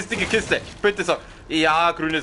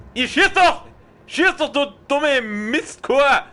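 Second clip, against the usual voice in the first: frustrated yell; 6 semitones higher than usual. Real, not acted. Yelling at game character.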